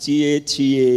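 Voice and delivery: lightly voiced teasing wife; coy tease from the wife; a hint of the wife's teasing cie cie. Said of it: "kalau istri Bapak bilang".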